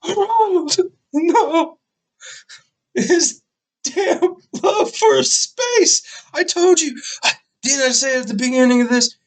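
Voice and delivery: very high-pitched cry of shock; distraught wail. Real, not acted. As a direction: wail in disbelief at the tragic news. Shocked at Ronald's death in the story.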